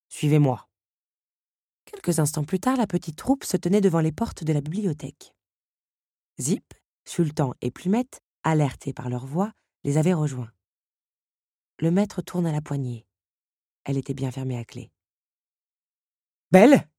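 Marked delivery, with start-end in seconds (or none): 0.1-0.7 commanding. A narrator's reading. 16.5-16.9 loud call with a rise. A narrator's reading. Beast calling Belle at the locked door, loud. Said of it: "appela-t-il".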